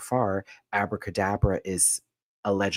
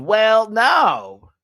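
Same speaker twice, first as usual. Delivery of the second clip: mock shrug; 14 semitones higher, 11 dB louder than usual. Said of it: "a moment of".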